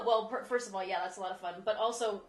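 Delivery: usual speaking voice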